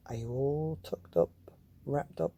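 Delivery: usual speaking voice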